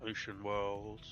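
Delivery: ordinary delivery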